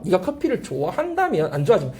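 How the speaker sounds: usual speaking voice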